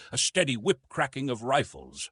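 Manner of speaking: ordinary delivery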